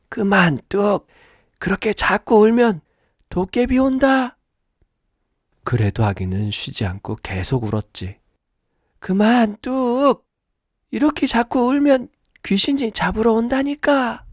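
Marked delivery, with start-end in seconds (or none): 0.1-4.4 high stern granny scolding; firm grandmother. Acted. Grandmother warning of goblins, high stern granny voice. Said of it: "단호한 목소리로". 9.0-14.3 high stern granny scolding; firm grandmother. Acted. Grandmother warning of goblins, high stern granny voice. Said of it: "단호한 목소리로".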